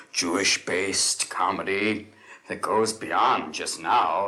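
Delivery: ordinary talk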